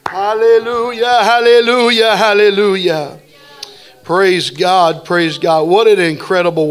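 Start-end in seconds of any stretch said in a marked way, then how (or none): 0.1-3.2 fervent worship. Real, not acted. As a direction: lead the congregation in sustained, intoned hallelujahs. Leads congregation in praise and prayer.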